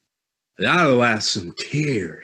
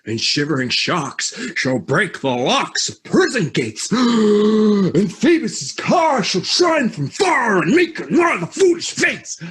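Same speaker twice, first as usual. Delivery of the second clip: ranting tyrant verse, building to a shout; hammed-up heroic declaiming; lofty ranting; 7 semitones higher than usual. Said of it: "That was lofty".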